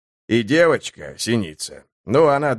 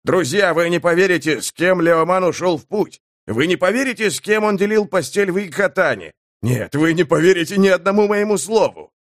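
higher, agitated exclaiming; insistent, pitched up, incredulous; worked-up announcement; 5 semitones higher than usual. Acted. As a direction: spill astonishing news to your comrades. Sapper's outburst of disbelief.

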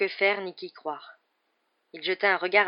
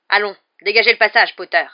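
loud, raised, angry command; impatient order; 4 semitones higher, 16 dB louder than usual. A narrator's reading. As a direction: force the boy out of the way. Rogue ordonne avec impatience. (ordre impatient et fort)

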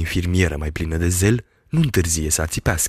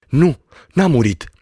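higher, emphatic denial; doctor's firm reply; 7 semitones higher, 4 dB louder than usual. A narrator's reading. Doctor answering the screaming girl, emphatic. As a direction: cut in to contradict her. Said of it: "răspunse doctorul Patac".

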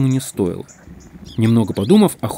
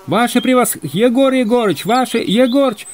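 high, carrying hail; calling out; 12 semitones higher, 3 dB louder than usual. A narrator's reading. Second group hailing the resting hunters, shouting. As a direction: call urgently across a field, tripping over names. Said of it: "закричала вторая группа".

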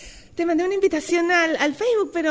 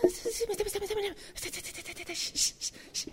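secret whisper; 4 semitones higher, 12 dB quieter, faster than usual. Acted. Whispering so he won't notice.

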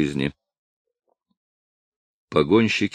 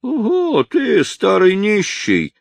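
jeering shout; 13 semitones higher, slower than usual. Acted. Drunk drovers jeer at the old man, shouting high. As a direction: hurl the insult, slow and high. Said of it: "вскричали в один голос".

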